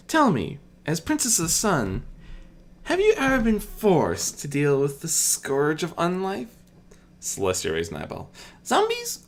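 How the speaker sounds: Medea's voice. Acted. Medea quizzing Celestia about the undead, a lightly voiced sly curiosity. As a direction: tease out the list of monsters with relish.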